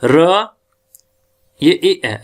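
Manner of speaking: ordinary delivery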